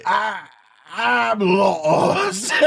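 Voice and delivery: high, mock-crying whale wail; comic whale-song sob, far above normal pitch; lost whale. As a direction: wail pitifully like a lonely whale. Acts the unheard, lost whale.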